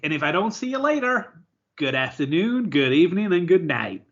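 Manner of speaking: cheery American Truman greeting; a bad American Jim Carrey impression; American accent. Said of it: "witnessed [name]'s accent".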